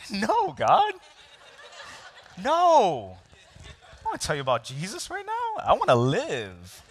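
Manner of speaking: high, panicked pleading with God; comic terror, voice cracking high; mock panicked plea. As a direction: beg God to let you off, half joking.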